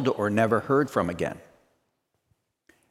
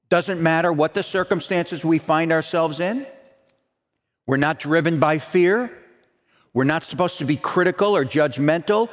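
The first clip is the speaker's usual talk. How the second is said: higher, ringing pulpit voice, slowing at the end; rousing preaching; 7 semitones higher than usual. Acted. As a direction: preach it with conviction. Preaching at full force.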